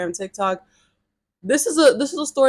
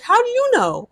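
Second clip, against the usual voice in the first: much higher, loud demanding question; incredulous challenge; 14 semitones higher, 4 dB louder than usual. Retold or imitated. Arguing on the phone with a homophobic guy, incredulous and loud. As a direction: throw it back at him in disbelief.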